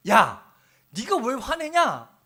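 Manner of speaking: slow, loud, pointed question; God questioning Jonah. Retold or imitated. God asking Jonah why he is angry, slow and loud. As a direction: press him slowly on his anger. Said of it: "하나님이 요나에게 이렇게 물었습니다".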